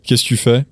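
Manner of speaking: "Qu'est-ce que tu fais" run together, que swallowed; linked casual contraction. Shown as a demonstration. Teacher showing how it sounds together, casual and linked. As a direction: slur it into three quick syllables, "kess-tu-fais". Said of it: "that would sound like this".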